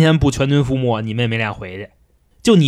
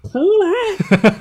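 high, long sustained call; a Monkey King summoning his cut-off head; calling the head back. Shown as a demonstration. Monkey King calling his severed head back, high ringing call. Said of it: "我给学学啊".

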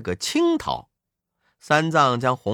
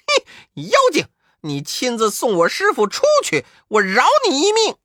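higher, louder and fast commanding shout; 9 semitones higher, 5 dB louder than usual. A narrator's reading. The monkey shouts at the demon to carry his master out, loud and fast. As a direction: bark orders from inside the belly. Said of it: "悟空叫道".

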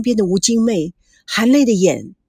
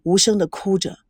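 ordinary delivery

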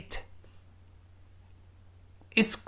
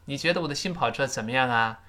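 ordinary delivery

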